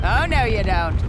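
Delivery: ordinary talk, nothing marked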